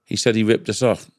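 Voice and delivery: usual speaking voice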